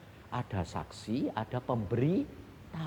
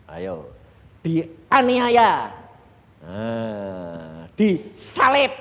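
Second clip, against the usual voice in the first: preaching at full force; 8 dB louder, slower than usual. Acted. Preacher drives point home loudly.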